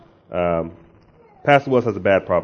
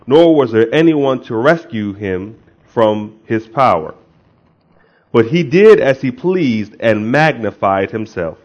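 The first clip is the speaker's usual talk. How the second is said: loud, declaimed pulpit reading; scripture reading; 3 semitones higher, 5 dB louder than usual. Real, not acted. Preacher reading Daniel's vision to the congregation, declaimed scripture reading. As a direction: read it out with weighty, punched key words.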